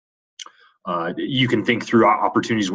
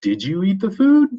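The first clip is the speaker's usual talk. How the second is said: "food" climbing high and louder at the end; rising question intonation; 11 semitones higher, 4 dB louder than usual. Shown as a demonstration. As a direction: ask it with the voice sweeping up to "food". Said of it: "sometimes your voice rises".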